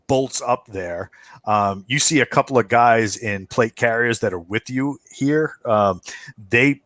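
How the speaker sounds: usual speaking voice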